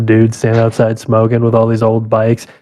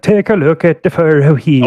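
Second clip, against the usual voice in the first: lightly higher, hammed-up Swedish accent; bad Swedish accent; 4 semitones higher than usual. Imitating the little mechanic Rolf, bad Swedish accent. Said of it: "a fucking bad Swedish".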